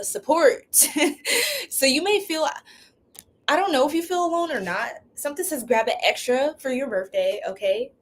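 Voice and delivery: normal talking voice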